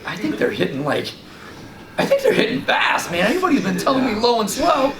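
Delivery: high, laughing, half-whispered dude; giddy disbelief as it dawns on you; excited laugh of discovery; laughing realisation. Retold or imitated. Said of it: "And I'm like".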